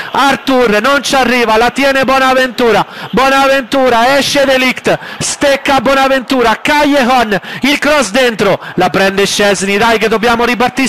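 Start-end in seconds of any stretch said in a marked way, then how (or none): 0.1-8.6 breathless italian goal commentary. Acted. Live play-by-play.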